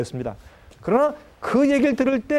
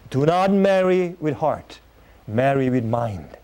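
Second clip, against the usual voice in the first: slow, deliberate English saying; slow quoted maxim; 8 semitones lower, much slower than usual. Shown as a demonstration. Preacher slowly quoting an English maxim to the congregation, deliberate. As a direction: spell the maxim out word by word. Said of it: "영어로 이런, 이런 말이 기억났습니다".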